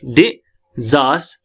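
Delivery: Disaster slowly split as di, zas, ter; slow teaching model of disaster; syllable by syllable. Shown as a demonstration. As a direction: sound out disaster with pauses between syllables. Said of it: "लेकिन transcription में डिजास".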